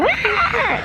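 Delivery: high-pitched mock scream; imitated scream. Vlogger imitating the girl screaming in the cave, mock scream. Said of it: "then she screams and she's like".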